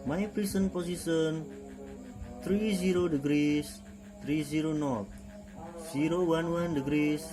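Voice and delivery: calm radio operator call; marine radio. Shown as a demonstration. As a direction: read it like a VHF radio exchange. Radio call demo dialogue.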